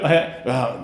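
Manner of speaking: ordinary talk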